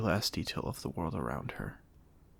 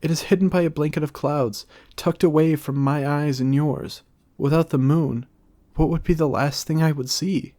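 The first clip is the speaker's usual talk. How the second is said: higher, louder, fast grand declamation; grand theatrical; 6 semitones higher, 9 dB louder than usual. A narrator's reading. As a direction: project to the back row. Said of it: "to gesture grand so that those in the back".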